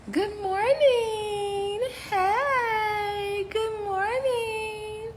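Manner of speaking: very high, very slow crooned greeting; sing-song good morning. Real, not acted. Welcoming viewers back to her live, high crooned greeting. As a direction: stretch it out like a cheerful song.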